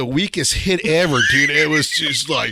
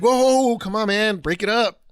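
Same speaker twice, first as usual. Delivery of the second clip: raised voice, breaking up a fight; calling out; 6 semitones higher than usual. Retold or imitated. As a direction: whoa whoa, pull them apart.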